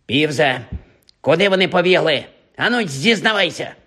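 вимогливо, з підвищенням голосу; допитуй, вимагай зізнання; суворий допит. Acted. Філч допитує Півза. (суворо випитує)

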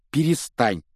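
embarrassed exclamation; exclaiming. A narrator's reading. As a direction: cut in with a quick objection. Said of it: "воскликнул Гарри".

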